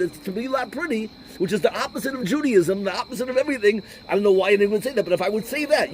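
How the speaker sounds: high-pitched, exasperated mock telling-off; retold indignation. Rabbi scolding the questioner for attacking a made-up reason, mock exasperated. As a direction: argue back indignantly, pitch held high.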